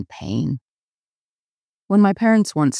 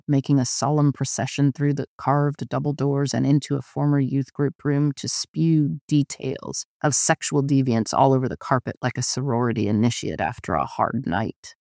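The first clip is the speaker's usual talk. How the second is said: ordinary delivery, nothing marked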